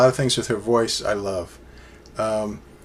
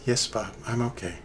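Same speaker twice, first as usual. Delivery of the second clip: much softer mock Lana voice, meek; voicing Lana softly; 10 dB quieter than usual. Lana reassuring Bob, quiet meek mock voice. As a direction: answer like a small, calmed Lana.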